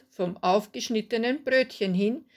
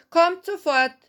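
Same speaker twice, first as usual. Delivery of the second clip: higher, louder call; 9 semitones higher, 6 dB louder than usual. A narrator's reading. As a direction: call it out to the waiting customers. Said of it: "rief Hilde".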